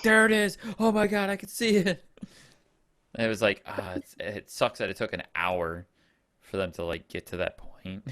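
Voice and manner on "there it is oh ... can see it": high, loud freaking-out cry; freaked-out shout. Retold or imitated. Imitating Todd spotting the ghost, loud high panic. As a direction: yell it like you just spotted the ghost. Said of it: "He's like".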